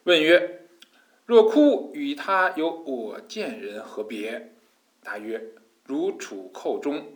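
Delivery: slow, measured scripture reading; classical text recitation. Real, not acted. Teacher reading the master's question and answer aloud, measured recitation. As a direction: recite it phrase by phrase, unhurried.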